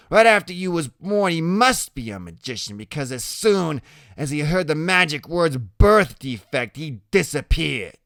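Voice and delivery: high, loud opening, easing slightly; Loretta, mocking. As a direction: deliver a cruel taunt as Loretta. Loretta's cruel joke.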